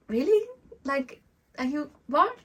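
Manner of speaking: high, loud squeak of disbelief; amazed disbelief. Retold or imitated. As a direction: gasp it out, as if you can't believe your ears. Spirit guides amazed at forgetting.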